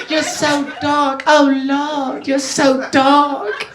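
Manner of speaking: high, slow Southern belle drawl; Southern belle accent. Acted. Girlfriend to her boyfriend in the bit, Southern belle accent. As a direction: stretch it out like a swooning Southern belle. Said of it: "she's a Southern belle".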